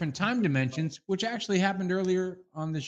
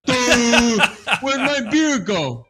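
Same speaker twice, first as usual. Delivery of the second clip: laughing; 5 semitones higher, 8 dB louder, much slower than usual. Real, not acted. Laughing co-host, mid-bit.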